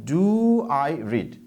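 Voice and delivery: very slow, each word drawn out; teacher dictating a question word by word; slow dictation. Shown as a demonstration. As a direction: spell it out slowly for the class to write. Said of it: "সুতরাং আমরা লিখব".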